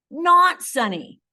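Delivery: "not" high, loud and slowed; stress on not. Shown as a demonstration. Teacher showing the inverse, punching "not". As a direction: hit "not" hard to show the flip. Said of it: "you say the opposite".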